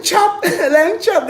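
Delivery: high, loud, cracking up; laughing at own joke. Real, not acted. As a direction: blurt the nickname through laughter.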